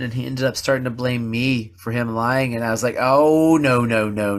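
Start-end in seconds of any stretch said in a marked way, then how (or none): 3.0-4.4 ticked-off protest. Retold or imitated. Dad rejecting his son's blame, loud and irritated. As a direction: shut down the blame right away. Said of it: "I was still pretty ticked".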